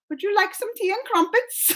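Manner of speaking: high, playful British accent. Shown as a demonstration. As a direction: put on an amateur British accent. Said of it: "my go-to phrase for British accent".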